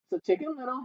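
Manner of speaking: usual speaking voice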